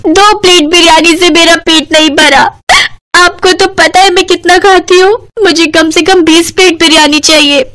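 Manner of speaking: high, hammed-up tearful wail; crying loudly. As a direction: whine in a high sobbing complaint. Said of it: "Shefali जोर जोर से रोने लगी".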